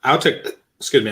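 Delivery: hiccuping mid-sentence; words broken by a hiccup. Real, not acted. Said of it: "I got the hiccups".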